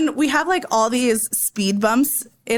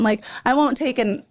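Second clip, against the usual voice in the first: usual speaking voice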